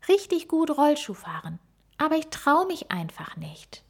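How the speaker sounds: a hint of a shy child's longing; little gorilla girl. A narrator's reading. Frieda answers the owl.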